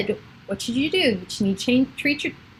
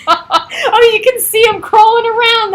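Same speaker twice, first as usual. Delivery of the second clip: much higher, louder, laughing; laughing delight; 12 semitones higher, 6 dB louder, slower than usual. Real, not acted. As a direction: giggle it out, delighted. Laughing at kids under table.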